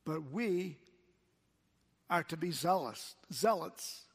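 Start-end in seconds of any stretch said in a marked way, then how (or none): none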